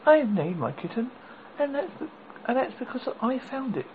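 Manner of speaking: put-on little girl voice. A narrator's reading. Dorothy explains the name.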